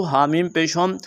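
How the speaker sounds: ordinary delivery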